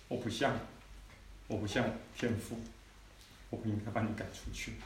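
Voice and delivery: 轻声诚恳地道歉; 低声放软，真心求原谅; soft apology. Retold or imitated. Father apologizes to son. (父亲坐在孩子旁边轻声诚恳道歉)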